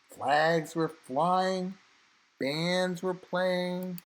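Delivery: usual speaking voice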